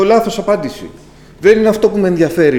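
high, loud and fast indignant objection; retold quarrel with God; exasperated protest. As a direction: push back at God, fed up. Retold arguing back with God.

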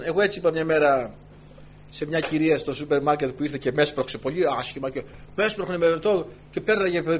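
normal talking voice

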